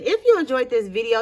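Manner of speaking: plain reading voice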